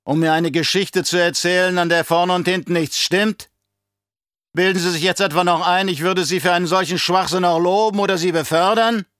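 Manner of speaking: loud, high, scornful rant; bellowed rhetorical questions, climbing at the end; angry bellow. A narrator's reading. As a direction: tear into the subordinate at a roar. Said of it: "brüllte der Baron".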